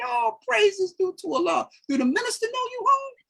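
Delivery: high, excited woman's voice, fast at the end; Sister Lisa, delighted. Retold or imitated. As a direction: play her joy high and quick.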